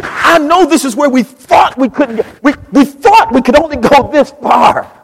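loud, high preaching cry; full-force preaching. Acted. Preacher lifting his congregation in a loud high cry. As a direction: preach it out with rising fire.

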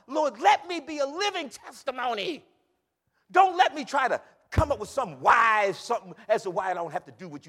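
loud, driving prayer from the pulpit; full-force preaching. Real, not acted. Preacher praying to be a living testimony, fervent shout.

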